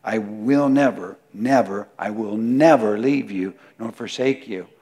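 "never" 一再重读，更响; 层层加重 never; emphatic repeated never. Shown as a demonstration. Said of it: "It's just emphatic".

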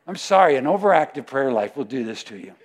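normal talking voice

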